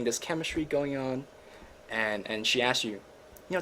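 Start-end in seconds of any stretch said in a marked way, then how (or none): none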